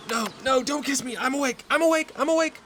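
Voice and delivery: high, frantic fending off; panicked protest. Acted. Fends off rotting wife's kiss.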